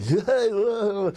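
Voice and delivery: high hooting "yeah, yeah" from the back seat; the guys whooping along in the limo; excited guys cheering. Retold or imitated. Guys in the limo cheering [name] on, hooting.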